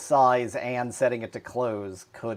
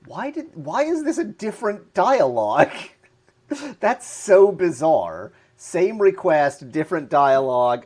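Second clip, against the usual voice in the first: baffled; 6 semitones higher, 6 dB louder than usual. Real, not acted. Bizarre bug, laughing disbelief.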